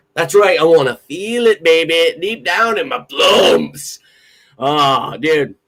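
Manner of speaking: slow, drawn-out shout; comic hype shout. Real, not acted. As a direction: stretch it out, big and loud.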